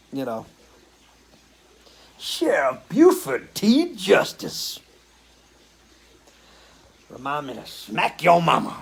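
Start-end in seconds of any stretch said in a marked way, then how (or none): 2.2-8.8 slow, drawn-out Southern sheriff voice, pitched up at first; Sheriff Buford T. Justice. Pretend. Showing off an accent to his chat, hammed-up Southern sheriff voice. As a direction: play a blustering small-town Southern lawman, slow and heavy. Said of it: "What accent you wanna hear?".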